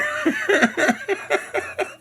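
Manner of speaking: bursting into laughter; cracking up at the memory; high laugh. Real, not acted. Laughing at his own lunch story.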